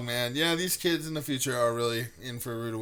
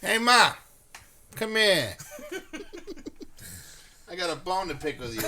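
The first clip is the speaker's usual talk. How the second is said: mock Boston call; 4 semitones higher than usual. Boston bit.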